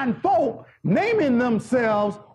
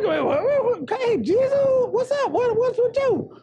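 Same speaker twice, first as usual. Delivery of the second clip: higher-pitched mock protest; disciples' protest; 10 semitones higher than usual. Disciples confronting Jesus in the storm, mock high protest. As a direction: plead in a lightly acted raised voice. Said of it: "And they're like".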